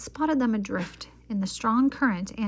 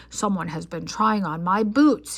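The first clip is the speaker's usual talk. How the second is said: louder booming bear voice; big bear voice; 7 dB louder than usual. A narrator's reading. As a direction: boom it like a giant bear. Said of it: "he boomed in his big bear voice".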